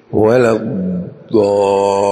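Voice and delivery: الضالين slow, with held ض and ل; heavy, lengthened الضالين; doubled dad and lam. Shown as a demonstration. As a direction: draw out الضالين, doubling both consonants. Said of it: "الضاد مشددة واللام مشددة".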